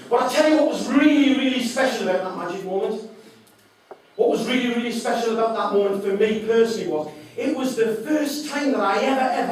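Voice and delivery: loud, high, thrilled telling; bursting with joy at the memory; excited. Real, not acted. Speaker tells the audience about his magic moment, loud and excited.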